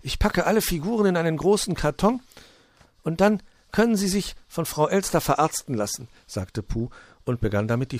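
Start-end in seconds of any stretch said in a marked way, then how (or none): 0.0-6.1 higher, emphatic storybook helper; Puh, decisive. A narrator's reading. Puh offering to pack the figures, high and decisive. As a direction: take charge in a pitched-up character voice.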